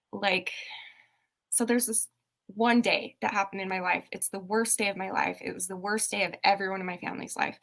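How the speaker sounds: ordinary delivery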